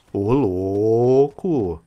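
very slow, stretched Ô, louco; lazy stretched amazement; drawn-out wow. Real, not acted. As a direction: stretch it out in disbelief. Stunned by enemy attack.

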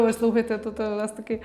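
normal talking voice

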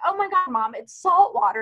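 high-pitched, incredulous explaining to mom; retold exasperation. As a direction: replay an amused, exasperated realization. Acting own exasperated reply to mother.

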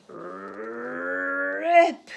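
loud sudden rip sound. Acted. The potato finally rips free, loud. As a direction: burst it out.

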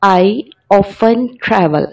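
very slow, word by word; slow example sentence. Shown as a demonstration. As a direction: read it out slowly for learners. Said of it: "example sentence".

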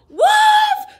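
high, loud alarmed scream; frantic cry. Acted. Boy cries to townsfolk who won't listen, high loud scream. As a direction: scream for help. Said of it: "cried Willie".

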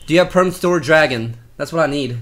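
ordinary delivery

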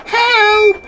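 high, loud panicked wail; frightened wail. A narrator's reading. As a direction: wail it out in terror. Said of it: "he wailed".

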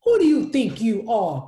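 loud, haughty and offended; indignant cry. Acted. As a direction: snap back, proud and insulted. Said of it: "cried Rainbow Fish".

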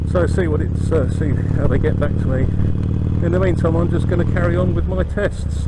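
through a helmet mic with wind; motorbike helmet intercom. Real, not acted. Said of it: "I can hear wind noise in the helmet".

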